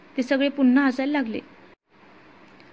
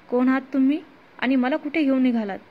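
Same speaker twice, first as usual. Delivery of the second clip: ordinary delivery, nothing marked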